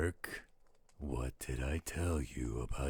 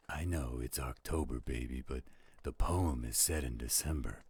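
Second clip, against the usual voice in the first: usual speaking voice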